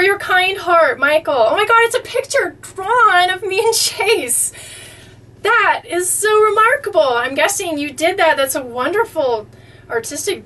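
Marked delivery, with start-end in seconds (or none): 1.3-7.0 high, bright with amazement; high, amazed praise; touched excitement. Real, not acted. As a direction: marvel at the gift. Admiring the drawing.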